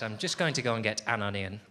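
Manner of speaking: careful, low "an onion", fully separated; over-correct an onion. Shown as a demonstration. Comic retaking his line, stiffly correct "an onion". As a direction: enunciate AN and ONION as separate words. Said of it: "that's not right either".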